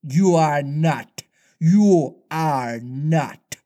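very slow, drawn-out denial; understated hoarse captain's protest; hoarse cry. A narrator's reading. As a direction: deny it, stretching each word. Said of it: "Hook cried hoarsely".

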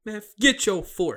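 hammed-up censored cussing; movie quote. Retelling the fort scene's dubbed line, lightly voiced. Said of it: "He said".

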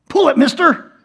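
put-on high, loud urging kid voice; imitated impatient kid. Kid urging the frozen man to pull, high loud imitation. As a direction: call out like an eager youngster. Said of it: "And the kid goes".

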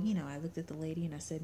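usual speaking voice

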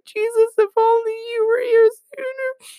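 very high, hammed-up sobbing voice; mock crying Mary. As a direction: wail it like an exaggerated weeping sister. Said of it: "and she's like".

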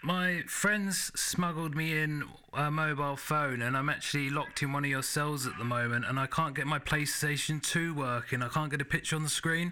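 prank caller voice. Pretend. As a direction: play a clueless prank caller. Prank call in a put-on voice.

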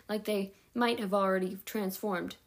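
ordinary delivery, nothing marked

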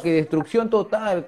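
ordinary talk, nothing marked

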